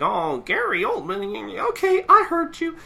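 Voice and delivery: put-on very high comic old-man voice; high, wavering old-timer; hard-of-hearing old man. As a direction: play a doddering old codger answering. Plays the old man replying.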